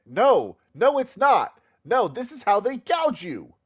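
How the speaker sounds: loud, high indignant outburst; indignant shout. Real, not acted. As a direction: shout it down in outrage. Outburst against game text.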